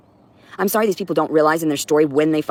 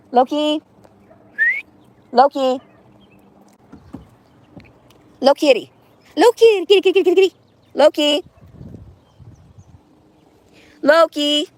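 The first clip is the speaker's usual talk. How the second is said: high, loud calling a cat; 12 semitones higher, 4 dB louder than usual. Real, not acted. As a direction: call the cat from the door.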